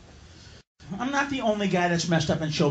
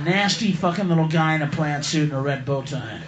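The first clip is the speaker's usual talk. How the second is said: ordinary talk, nothing marked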